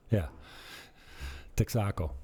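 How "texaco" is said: TEXACO as tek-SA-co, stress on SA; local pronunciation. Shown as a demonstration. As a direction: land on SA in TEXACO, Spanish-style. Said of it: "they put the accent on the end".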